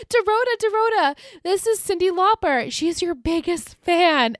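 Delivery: high, loud excited calling; excited Blair. Retold or imitated. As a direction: call her over in a giddy rush. Blair excitedly calls her maid.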